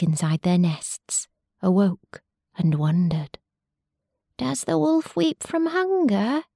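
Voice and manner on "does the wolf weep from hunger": high, small chick voice, wondering; baby sparrow voice. Acted. Baby sparrows asking their mothers about the wolf, high chick voice. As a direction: pipe it up high like a baby bird. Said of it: "they asked their mothers".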